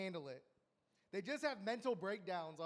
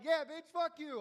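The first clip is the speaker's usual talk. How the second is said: loud, higher mock taunt; mock yell; 8 semitones higher, 9 dB louder than usual. Comic jeering at Jesus on the way to the cross, mock yell. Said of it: "You would've been like".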